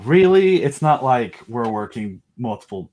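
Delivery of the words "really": high, loud sarcastic really; mock surprise; dry sarcasm about work.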